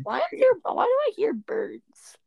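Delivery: high, squeaky bewildered question; high-pitched disbelief; baffled surprise. Real, not acted. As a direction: shoot the voice way up in bafflement, then draw it out. Startled by sudden bird sound.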